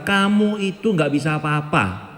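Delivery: a hint of condescension, drawn out; slow belittling. Parents implicitly telling their child he is helpless, slow. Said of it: "mengatakan pada anak kita".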